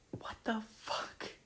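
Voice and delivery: high, slow whispered disbelief; shocked whisper. Real, not acted. Horrified at her old caption, whispering. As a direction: gasp it out under the breath. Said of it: "shocked by emoji count".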